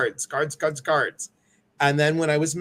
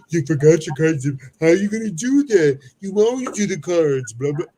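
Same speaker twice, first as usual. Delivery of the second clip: hammed-up mimicry, higher and louder; exaggerated upset client; 3 semitones higher, 5 dB louder than usual. Card reader mimicking the woman's reaction, exaggerated upset squawk. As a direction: imitate a client making a big fuss. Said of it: "She didn't talk like that, guys, but that's my interpretation".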